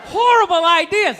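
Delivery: ordinary talk, nothing marked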